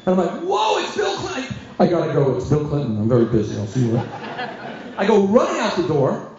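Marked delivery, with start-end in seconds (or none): 0.4-1.5 high, drawn-out excited whoa; high-pitched star sighting; starstruck exclamation. Acted. Acted sighting of famous ex-president. 1.8-4.1 low, casual phone sign-off; mock-important, offhand goodbye; self-important brush-off. Acted macher ending phone call.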